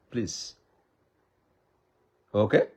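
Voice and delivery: usual speaking voice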